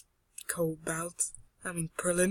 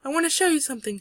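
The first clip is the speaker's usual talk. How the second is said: high, louder character voice; eager girl; 6 semitones higher, 5 dB louder, much faster than usual. Acted. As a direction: announce it eagerly with raised pitch. Vaxia's line repeated.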